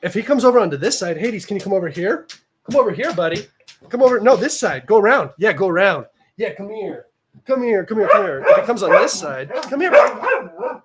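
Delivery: high, loud coaxing call to a pet; high sing-song pet calling; calling the cat. Real, not acted. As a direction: coax the cat over to this side.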